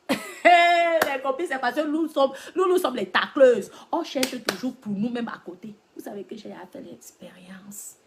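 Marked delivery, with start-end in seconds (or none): 0.1-5.7 animated, full-voiced outburst; loud exclamation. Real, not acted. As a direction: cry out then keep it loud. Exclaiming to her girlfriends.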